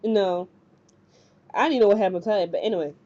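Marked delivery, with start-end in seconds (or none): none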